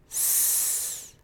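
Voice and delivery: soft, long snake-like hiss; sustained S sound. Shown as a demonstration. Teacher shows learners the s sound, a soft hiss. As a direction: gently blow a long sss through the teeth. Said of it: "Let's start with the S sound".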